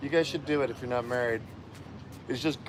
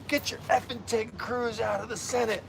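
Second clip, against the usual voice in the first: higher, slow, voiced as an angry woman; imitating a furious stranger's rant; half-hearted mock rage at a passerby; mock ballistic yell; 7 semitones higher, 3 dB quieter than usual. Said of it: "she just comes up ballistic on me".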